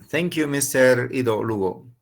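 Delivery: ordinary talk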